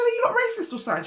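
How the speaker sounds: very high, rapid question; retold indignant challenge. Challenging the neighbour across the road, rapid indignant question. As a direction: blurt out a high-pitched accusation.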